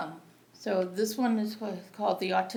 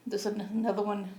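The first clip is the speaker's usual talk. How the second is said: normal talking voice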